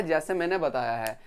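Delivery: ordinary talk, nothing marked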